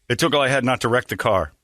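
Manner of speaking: ordinary delivery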